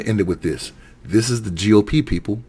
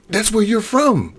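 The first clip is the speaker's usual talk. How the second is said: sarcastic jab; 14 semitones higher, slower than usual. Real, not acted. Mocking the senator's hypocrisy.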